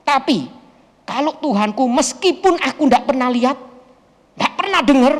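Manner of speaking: emphatic, insistent retelling of a vow; defiant declaration. Retold or imitated. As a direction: declare your faith forcefully against the threat.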